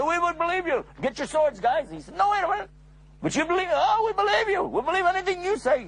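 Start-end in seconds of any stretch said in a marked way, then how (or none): 0.0-1.9 high, eager townsfolk answering; keen to grab their swords; a lightly voiced eager crowd. Crowd pledges belief. 2.2-5.9 very high, fast insistent voices; hammed-up eager crowd. Crowd insisting they believe.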